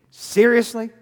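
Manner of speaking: loud, high disbelief; incredulous. Acted. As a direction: demand it in disbelief. Pilate incredulous at Jesus's silence.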